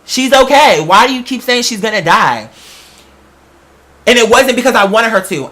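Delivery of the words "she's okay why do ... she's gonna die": ordinary talk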